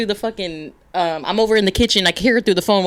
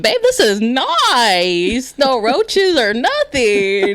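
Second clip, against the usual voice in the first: very slow, drawn-out gushing; hammed-up, singsong admiration; mock delight; 4 semitones higher, much slower than usual. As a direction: stretch each key word high, hamming up the thrill. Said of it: "I'd be in the toilet like".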